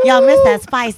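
high, loud teasing call; loud hype. Real, not acted. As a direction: shout it to hype the crowd. Hype shout.

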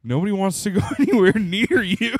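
high and loud through laughter; laughing retold insult; laughing mock jab. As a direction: tease him while cracking up. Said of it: "And I'm like".

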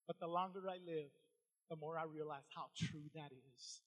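very soft, low, reflective delivery; quiet, intimate sincerity from the pulpit; hushed preaching. Real, not acted. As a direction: let it sink in with long pauses.

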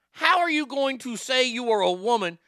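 high, measured word-by-word challenge; indignant, carefully separated words; incredulous, spelled out. Real, not acted. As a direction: spell out each word in disbelief. Repeats question word by word.

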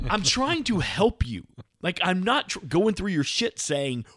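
high, stretched-out protest; mock pleading. Husband insisting he is helping wife, high and pleading.

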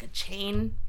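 normal talking voice

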